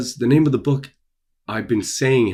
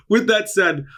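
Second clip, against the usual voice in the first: still laughing; 11 semitones higher, 4 dB louder than usual. Real, not acted.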